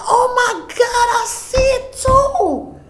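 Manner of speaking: drawn-out wonder as the sign appears; amazed. Retold or imitated. Daughter sees the sign too.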